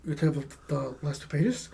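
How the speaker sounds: soft, low-energy questioning; subdued student. Retold or imitated. Student questioning teacher about losing pages, subdued. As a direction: imitate a student asking quietly in disbelief.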